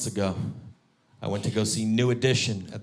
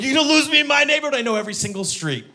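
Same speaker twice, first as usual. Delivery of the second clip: high, fast, loud taunting; fast mocking brag; cocky taunt; 7 dB louder, faster than usual. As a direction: gloat at the driver. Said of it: "I'm like".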